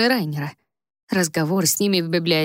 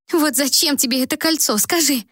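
higher, tearful pleading; through tears; 7 semitones higher than usual. A narrator's reading. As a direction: ask while holding back tears. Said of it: "сквозь слёзы спрашивала у неё сестра". (сквозь слёзы)

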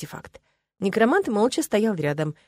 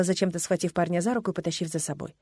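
ordinary delivery, nothing marked

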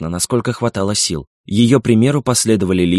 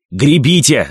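громкий высокий приказ; заори гребцам во весь голос; вопль; 5 semitones higher, 5 dB louder, slower than usual. A narrator's reading. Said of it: "завопил сеньор Кастильо". (крик-команда)

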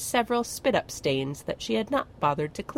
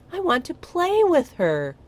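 put-on high, louder childish whine; whining; 11 semitones higher, 6 dB louder than usual. Tiny dragon begging to play with the baby, whining. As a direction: plead like a sulky child. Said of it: "he whined".

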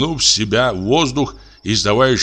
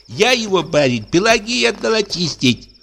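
high servant voice; a sleepy nanny answering the master, pitched high; timid nanny; 10 semitones higher than usual. Acted.

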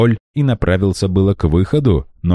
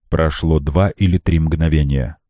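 usual speaking voice

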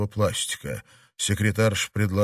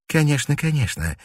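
hasty; 5 semitones higher than usual. A narrator's reading. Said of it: "поспешно согласилась та".

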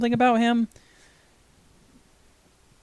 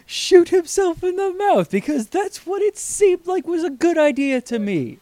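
exasperated; 13 semitones higher than usual. Real, not acted. Mocking exasperation.

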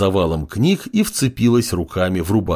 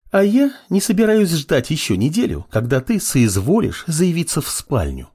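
high-pitched female comeback; parry back insistently, starting high; sharp retort; 8 semitones higher than usual. A narrator's reading. Girl tired of waiting for apprentice, retorting. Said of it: "парировала Левитания".

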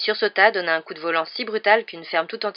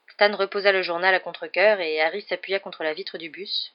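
ordinary delivery

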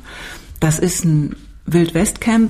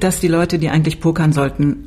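ordinary delivery

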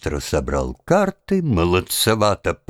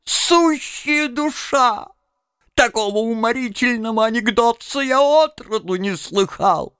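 laughing to tears; 11 semitones higher than usual. Acted. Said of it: "проговорил он, плача".

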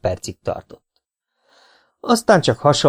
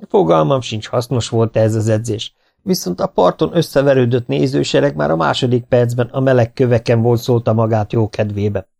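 ordinary delivery, nothing marked

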